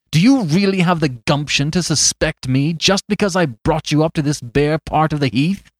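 loud, fierce challenge; increased intensity. Acted. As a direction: press him hard, heated. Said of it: "with increased intensity".

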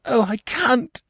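very high, anguished wail; acted wild sobbing plea; distraught cry. A narrator's reading. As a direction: cry out in despair, voice pitched right up. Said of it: "she cried".

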